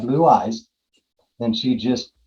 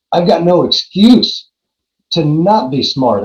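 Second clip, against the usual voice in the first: emphatic declaration; 5 semitones higher, 6 dB louder than usual. Real, not acted. Triumphant climax of story.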